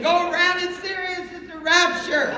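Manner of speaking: high, urgent holler at traffic; frantic hollering. Retold or imitated. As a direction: yell a desperate warning, played for laughs. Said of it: "we're hollering".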